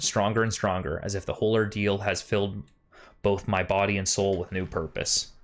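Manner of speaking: solemn storytelling read; diary narration. Acted. Reads diary entry in game.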